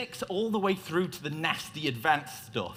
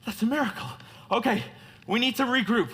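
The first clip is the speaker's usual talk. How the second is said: coughing, higher, acted crash survivor; mock out of breath; 8 semitones higher than usual. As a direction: gasp it out as if just crawled from a wreck. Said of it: "I'm out of breath".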